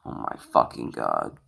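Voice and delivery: low, soft exasperated mutter; fed up. Real, not acted. Camera still won't focus, low fed-up mutter. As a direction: curse under your breath, drained.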